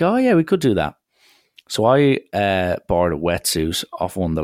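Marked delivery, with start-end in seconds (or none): 0.0-1.0 eager agreement. Retold or imitated. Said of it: "And they were like".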